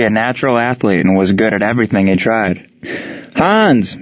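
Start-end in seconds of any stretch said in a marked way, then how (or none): 3.3-4.0 called out across the field; yelled name. A narrator's reading. Mueller calling out to Hans on the field, yelling. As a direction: shout the name to a player. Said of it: "Mueller yelled".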